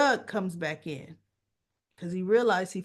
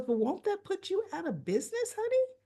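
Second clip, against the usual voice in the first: much higher mock-wifely question, lilting up; imitated concerned wife; 13 semitones higher than usual. Wife questions her husband's story, imitated high and questioning. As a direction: put on a high wife voice, rising on honey.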